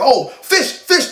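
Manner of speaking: greedy delight at the fish; hungry crowd's happy shout; excited cry. Retold or imitated. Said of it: "The guys were like".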